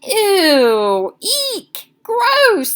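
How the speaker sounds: shrill, loud squeals of disgust; girls screaming. A narrator's reading. The girls at lunch, spattered with crumbs, screaming in disgust. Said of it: "the girls screamed".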